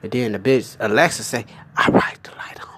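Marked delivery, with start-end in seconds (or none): 1.8-2.7 breathy whispered reply, no voice. Retold or imitated. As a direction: whisper it like a secret device. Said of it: "did this bitch just whisper".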